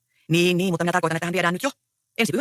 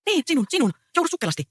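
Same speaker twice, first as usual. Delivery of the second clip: lightly voiced girl; 10 semitones higher than usual. Girl Pirkko urges Mikko out.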